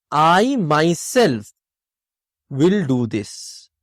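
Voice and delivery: "I myself" drawn out slowly, then "will do this" low; slow, weighted example sentence stressing "I myself"; emphatic. Shown as a demonstration. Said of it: "I am emphasizing the fact".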